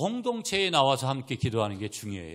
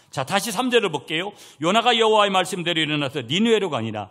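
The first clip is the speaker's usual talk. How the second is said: loud, high scripture proclamation; forceful preaching; 9 semitones higher, 12 dB louder than usual. Real, not acted. Preacher proclaiming Jonah obeying God, forceful preaching. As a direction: declare the verse to the congregation.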